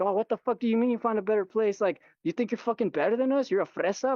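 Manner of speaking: high, mock-outraged neighbor voice; imitated indignant neighbor. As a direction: imitate a neighbor going off on you. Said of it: "she just went off on me".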